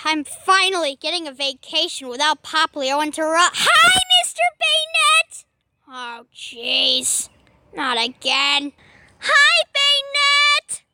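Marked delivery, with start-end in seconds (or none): 3.6-5.4 high squeaky creature yelling hello; shrill greeting scream. Acted. Poplio screams a greeting at Baynett, high and loud. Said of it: "why did you scream into my face". 9.2-10.8 squeaky creature voice. Acted. Poplio greets again.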